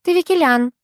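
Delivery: put-on light Armenian-tinged accent. A narrator's reading. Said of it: "с лёгким акцентом ответили".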